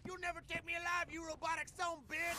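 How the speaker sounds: loud defiant yell; angry shout. Acted. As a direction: bellow the threat. Defiant threat to a supposed robot.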